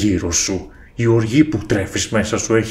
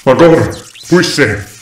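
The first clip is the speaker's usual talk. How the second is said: calling out; 3 semitones higher, 4 dB louder than usual. Acted. Calling for master in panic.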